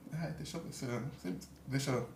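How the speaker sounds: soft, faltering mumble, unsure how to begin; awkward hesitant touch. Shown as a demonstration. Acting coach demoing an awkward, hesitant touch, mumbled softly. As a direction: fumble the words as if too shy to touch a colleague. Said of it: "Tipo, fica feio, fica chato".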